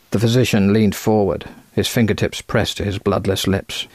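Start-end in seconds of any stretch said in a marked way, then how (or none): none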